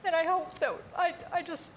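fighting back tears while speaking of new life; tearful. Real, not acted. Tearful on the radio, moved by calving season. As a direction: voice high and breaking with tears. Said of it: "I'm sorry, I wasn't gonna cry".

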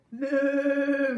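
loud, high playful moo; cow moo. Acted. Teacher voicing the cow in a picture book, loud playful moo. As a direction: moo it big for the children. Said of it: "said the cow".

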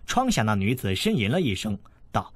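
ordinary delivery

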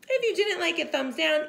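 plain reading voice

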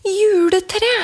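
put-on child suddenly hits on the answer; excited exclamation. A narrator's reading.

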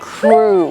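"crew" with long "oo", like Thai ครู; slow model "crew", no "iw" glide; correct pronunciation. Shown as a demonstration. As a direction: draw out "crew" as "kroo". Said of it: "สระอูเลยนะคะ".

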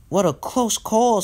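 high, slow exclamation; relieved exclamation. A narrator's reading. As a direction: draw it out after a near miss. Thomas relieved after near crash.

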